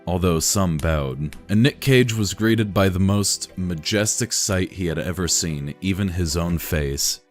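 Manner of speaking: usual speaking voice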